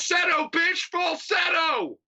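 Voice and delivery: high, hammed-up shout, drawn out; mock falsetto order. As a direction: bark the word like a pushy dad. Mock sports dad coaching singer.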